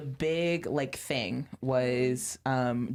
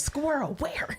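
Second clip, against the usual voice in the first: excited; 11 semitones higher, slower than usual. Pretend. Joking ADHD distraction.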